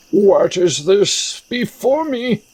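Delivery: theatrical, high-pitched question; puzzled game hero. Acted. Voices a character in the game.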